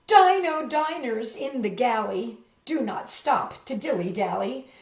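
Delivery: usual speaking voice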